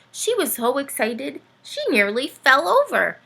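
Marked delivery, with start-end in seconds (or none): none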